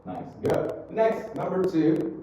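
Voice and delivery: usual speaking voice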